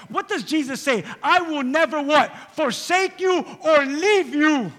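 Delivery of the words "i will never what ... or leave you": loud, high, slow declaration; emphatic promise. Acted. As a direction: proclaim the promise firmly. Quoting Jesus emphatically.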